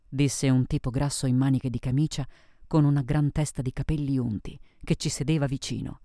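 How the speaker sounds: plain reading voice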